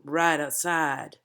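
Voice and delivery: right outside, drawn out slowly on close notes; slowed for emphasis. Shown as a demonstration. Coach demonstrating emphasis to the cast, slowing down. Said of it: "slowing down to emphasize certain words".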